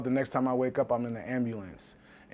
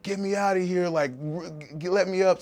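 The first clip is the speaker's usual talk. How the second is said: higher, sharp demanding outburst; retold cussing out; 6 semitones higher, 3 dB louder than usual. Cussing out the nurse who won't unstrap him, angry demand. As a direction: snap at the nurse to let you go. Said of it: "I start cussing this nurse out".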